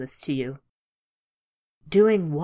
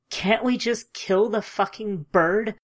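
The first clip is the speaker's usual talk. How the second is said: a raised, higher outburst, not full volume; shouted; 5 semitones higher, 3 dB louder than usual. Acted. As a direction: snap it out, moderately loud and pitched up. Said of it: "I shouted over Ethan's shoulder".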